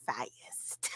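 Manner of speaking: breathy whisper of fast. Acted. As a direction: drop to a whisper. Drug high hitting.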